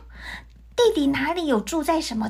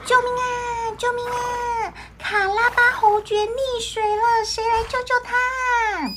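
high-pitched shout for help; crying for help; 10 semitones higher than usual. Acted. Cat calling out to the king's carriage for help, shouting high. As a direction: yell for help, much higher. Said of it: "赶快大叫的说".

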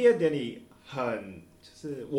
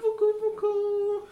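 mock comforting; slower than usual. Consoling friend over lost love.

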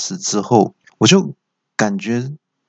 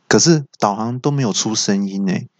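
normal talking voice

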